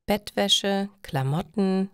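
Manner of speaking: ordinary delivery, nothing marked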